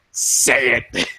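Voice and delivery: stern stare-down. Retold or imitated. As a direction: command it like you're staring him down. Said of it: "fucking stares him down".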